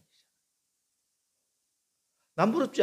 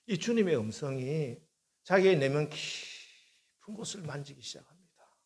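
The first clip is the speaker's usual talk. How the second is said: ordinary talk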